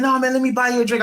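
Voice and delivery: high, fast insistent barfly; lightly voiced insistent drunk; pushy stranger. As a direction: rush the offer like a drunk.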